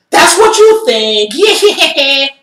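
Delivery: high, slow, otherworldly voice of the possessed man; eerie spirit voice. Retold or imitated. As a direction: stretch it out like a spirit speaking through him. Possessed man answering eerily.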